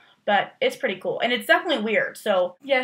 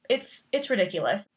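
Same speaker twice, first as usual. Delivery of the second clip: ordinary talk, nothing marked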